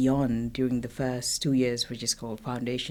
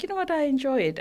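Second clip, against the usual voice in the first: much higher, pleased quoted self; happy realization; 12 semitones higher, faster than usual. Retold or imitated. Guest recalls returning to cardiology, quoting her pleased self much higher. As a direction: toss it off like a pleasant discovery. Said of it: "I was like".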